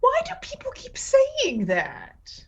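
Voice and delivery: high breathy whisper aside. Real, not acted. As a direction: whisper it in mock disbelief. Player whispers joke.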